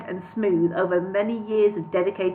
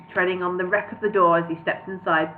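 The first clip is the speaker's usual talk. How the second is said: ordinary delivery, nothing marked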